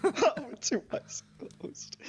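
high, slow laughing echo; laughing repeat. Real, not acted. Mock repeating the insult.

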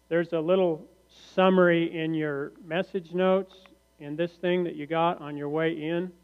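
usual speaking voice

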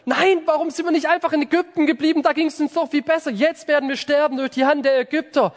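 high, fast panicky whining; whining Israelites. Retold or imitated. Preacher voices the fleeing Israelites, high fast whining complaint. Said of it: "Und dann so".

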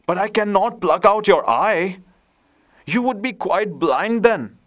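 high-pitched, alarmed objection; dismayed protest. A narrator's reading. Swallow protests at prince's order.

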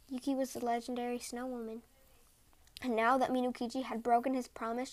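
plain reading voice

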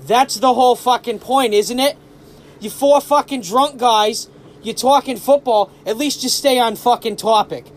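mock Boston guy ranting in Southie accent. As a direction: put on a touch of Southie accent. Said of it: "in a very thick South Boston accent".

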